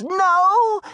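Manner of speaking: high, loud shouted no; yelled no. Acted. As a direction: shout it out in horror. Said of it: "Darla yelled".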